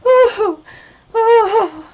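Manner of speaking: high gasping oh through laughter; winded oh after a laughing fit; breathless laughing oh. Real, not acted. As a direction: catch your breath mid-laugh.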